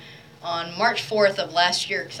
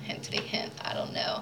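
coy whispered hint; teasing whisper hinting she wants flowers; sly whisper; 11 dB quieter than usual. Real, not acted. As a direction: drop the hint in a breathy aside. Sly aside about wanting flowers.

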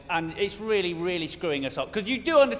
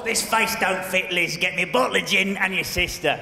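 put-on gruff old mother; 2 semitones lower than usual. Queen Mum demanding gin and Margaret.

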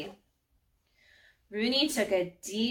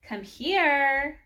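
high, stretched beckoning call; 6 semitones higher, 4 dB louder than usual. A narrator's reading. Maddie beckons Rooney over to the girls, calling out. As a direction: call someone over from afar. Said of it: "Maddie called".

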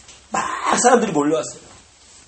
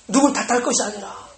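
ordinary delivery

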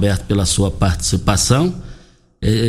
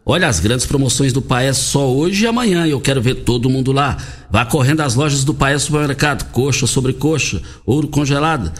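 high, punchy supermarket ad pitch; hard sell ad; 4 semitones higher than usual. Acted. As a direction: sell it hard like a radio promo.

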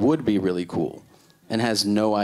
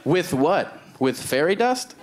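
higher-pitched, incredulous questioning; mocking, raised-pitch disbelief; sarcastic disbelief; 6 semitones higher, slower than usual. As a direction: challenge it as utter nonsense. Sarcastic challenge to cousin's invention.

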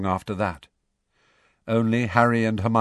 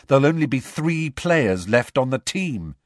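a touch of irritation; clipped impatience with a friend; mildly testy captain; 6 semitones higher, 4 dB louder than usual. A narrator's reading. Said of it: "said Harry testily".

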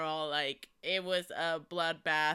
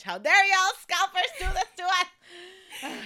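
high, loud laughing indignation; hammed-up fury at the scalpers; mock outrage; 12 semitones higher, 8 dB louder than usual. Real, not acted. Fan raging at scalpers with a laugh. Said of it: "mock outrage at scalpers".

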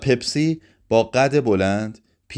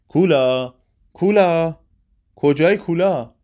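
high, loud calling of a name; shouting; 7 semitones higher, 4 dB louder, slower than usual. A narrator's reading. Running round the room calling for Kola, loud and high.

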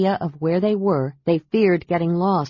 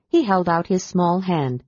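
ordinary delivery

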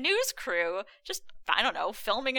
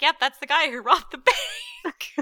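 laughing quote; 6 semitones higher, 5 dB louder than usual. Retold or imitated. Mock bank manager quote.

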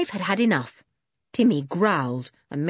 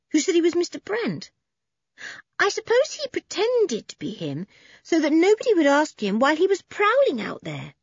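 put-on higher, curious girl's voice; young girl Anne; 10 semitones higher than usual. A narrator's reading. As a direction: wonder aloud like a young girl.

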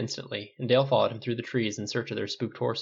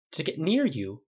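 higher, urgent question; demanding; 6 semitones higher than usual. A narrator's reading. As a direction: demand an answer. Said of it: "Paul demanded".

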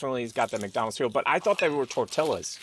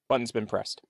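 ordinary talk

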